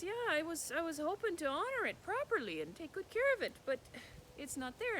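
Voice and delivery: lightly voiced boy; young boy's voice. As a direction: speak up like a polite young lad. Boy answers the troll.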